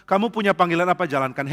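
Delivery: ordinary delivery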